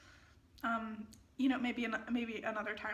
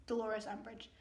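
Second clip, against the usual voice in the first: ordinary talk, nothing marked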